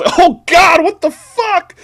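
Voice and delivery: high, loud cry of disgust; horrified yell. A narrator's reading. Jonah Hill heaving at a faint smell, yelling. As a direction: yell it in revulsion. Hill's horrified outcry in story.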